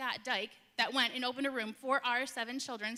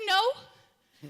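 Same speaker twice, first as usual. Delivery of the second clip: high, louder flat refusal; blunt no; 7 semitones higher, 10 dB louder than usual. Retold or imitated. Director answering the staff, loud blunt no. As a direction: own it bluntly, half-laughing.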